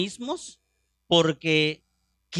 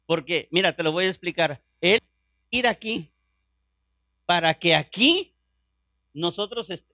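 ordinary talk, nothing marked

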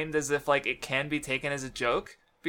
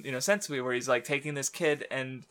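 ordinary delivery

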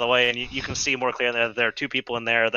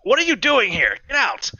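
high, loud demand to leave; angry shout; 12 semitones higher, 4 dB louder than usual. Acted. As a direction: chase the intruder away. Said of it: "They're like".

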